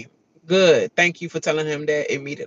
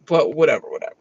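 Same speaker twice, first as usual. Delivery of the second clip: usual speaking voice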